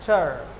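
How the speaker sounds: "टर्न" with no R, low and falling; silent R. Shown as a demonstration. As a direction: leave the R out of "टर्न". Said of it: "क्योंकि आर के बाद N".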